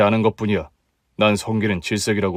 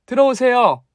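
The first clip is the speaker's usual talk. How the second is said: calling out; 12 semitones higher than usual. Acted. Calling family in.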